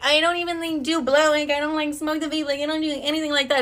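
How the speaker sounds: small high voice sending yourself up; self-mocking. Mocks her own smug past claims.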